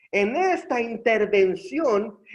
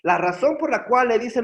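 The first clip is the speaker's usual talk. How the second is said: ordinary talk, nothing marked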